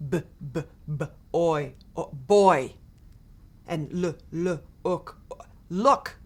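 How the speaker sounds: slow phonics drill, each sound pulled apart before the word; children sounding out "B, b, b, oi, o-, boy" and "l, l, ook, o-, look"; sounding out letters. Acted. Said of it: "sounding out letters and words".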